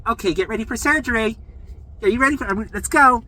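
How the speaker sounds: high, loud mock nurse call; rousing announcement. Staff waking a patient for surgery, rousing.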